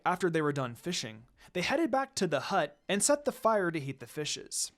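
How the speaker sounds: ordinary delivery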